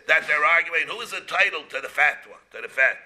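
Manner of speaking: ordinary delivery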